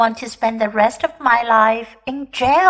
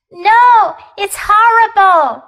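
loud, high wail of dismay, acted; crying out; 5 semitones higher, 6 dB louder, slower than usual. A narrator's reading. Upset teacher crying to her students, tearful outcry. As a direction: cry out in distress, raised and louder. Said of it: "Missus Ruppy cried".

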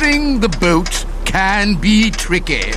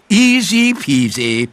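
smug lesson. Shown as a demonstration. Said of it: "I can teach Polly what to say".

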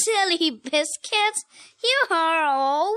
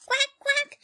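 high, bright quack; duck voice; 9 semitones higher than usual. Acted. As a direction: quack like a little duckling.

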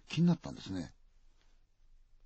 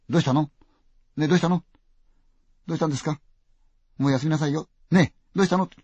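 higher and louder, pressing; urgent worried questioning; 5 semitones higher, 8 dB louder than usual. Acted. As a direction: shake her awake with questions. Nurse urgently questioning patient.

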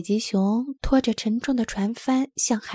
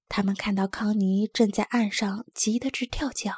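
ordinary delivery, nothing marked

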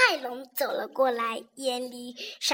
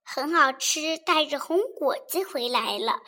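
usual speaking voice

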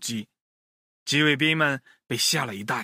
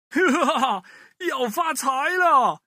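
greedy laugh; 9 semitones higher than usual. Acted. Thief gloating over a pile of stolen eggs, high laughing shout. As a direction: cackle over the loot.

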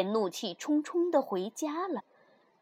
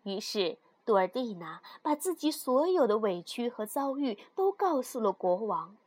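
plain reading voice

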